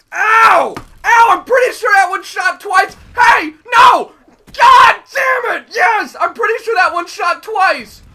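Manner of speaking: loud high furious yelling; raging gamer. Real, not acted. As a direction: scream at the game in anger. Raging while losing the match.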